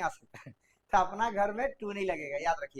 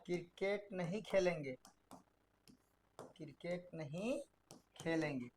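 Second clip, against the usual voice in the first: softer, lower slow dictation; 5 semitones lower, 12 dB quieter than usual. Shown as a demonstration. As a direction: dictate word by word. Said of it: "तीसरा देखते हैं".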